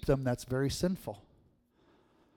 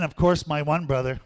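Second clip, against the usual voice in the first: ordinary delivery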